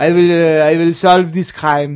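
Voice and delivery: hammed-up French-accented sleuth. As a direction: declare it in a mock French accent. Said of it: "sin French accent".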